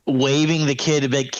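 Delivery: normal talking voice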